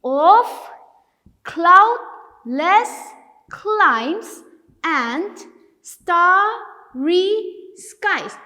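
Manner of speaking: each syllable of "Of cloudless climes and starry skies" alone, with pauses; syllable-by-syllable reading. Shown as a demonstration. Teacher breaking the second line down for viewers, separated syllables. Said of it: "Even in the second line, it is the same".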